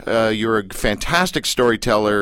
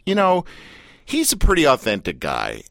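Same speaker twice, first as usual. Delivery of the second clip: ordinary delivery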